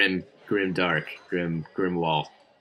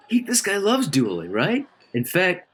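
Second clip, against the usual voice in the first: excited hype; 10 semitones higher than usual. Acted. Hyping up a duel.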